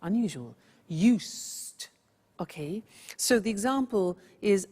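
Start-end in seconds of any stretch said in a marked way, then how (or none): 0.9-1.9 "Used" modelled slowly as YOOST; "Used" as one drawn-out syllable, no z. Shown as a demonstration. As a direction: stretch "Used" with a long s and crisp t. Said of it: "the pronunciation is not used, it's used".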